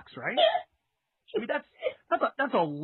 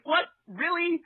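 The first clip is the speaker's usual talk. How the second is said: mock disbelief; 11 semitones higher, 3 dB louder than usual. Mock incredulous listener reaction.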